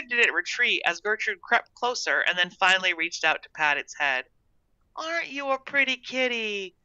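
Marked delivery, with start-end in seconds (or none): 5.0-6.8 high-pitched coo to a pet; cooing exclamation. A narrator's reading. Girl patting the cat's head, high cooing. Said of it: "Gertrude exclaimed".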